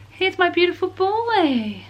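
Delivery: high, slow and doting. Real, not acted. Showing her baby to camera.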